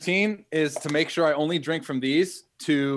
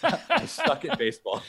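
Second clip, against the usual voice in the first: laughing. Real, not acted. Laughing while echoing host.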